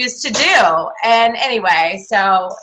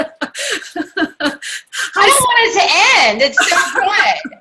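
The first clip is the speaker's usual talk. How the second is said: high, laughing gush; real delighted laughter in the voice; laughing praise; 8 semitones higher, much slower than usual. Real, not acted. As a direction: gush through your laughter, pitched up. Gushing reaction while laughing.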